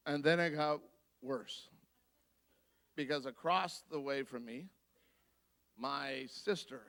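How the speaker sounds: normal talking voice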